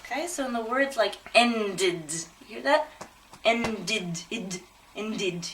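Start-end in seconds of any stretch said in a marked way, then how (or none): 1.4-2.1 "ended" in two clear syllables, en-did; careful, drawn-out "ended"; extra syllable. Shown as a demonstration. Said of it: "extra vowel sound". 3.4-5.5 "Ended" split clearly as en-did; careful "ended", vowel sounded before the d; extra syllable. Shown as a demonstration. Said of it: "that extra syllable".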